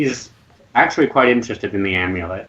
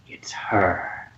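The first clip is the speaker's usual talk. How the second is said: low, unvoiced, aggressive whisper; 4 semitones lower, 8 dB quieter than usual. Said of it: "rather aggressive tone".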